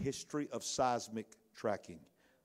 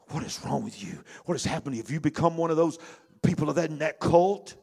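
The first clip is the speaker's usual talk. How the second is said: building from plain to loud, higher accusation; mock outraged rebuke; 5 semitones higher, 8 dB louder than usual. Pharisees turning on Nicodemus, outrage building louder. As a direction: scold him as if he's joined a cult.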